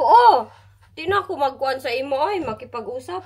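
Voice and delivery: loud huffy retort, rushed; mock huff. Wife sulking back at her husband, loud and fast. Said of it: "sabi ko".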